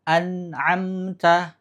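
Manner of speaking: an'amta drawn out, nun held smooth; slow an'amta. Shown as a demonstration. Teacher reading the correct example. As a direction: say an'amta slowly without bounce. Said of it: "Jangan sampai dibaca an'amta".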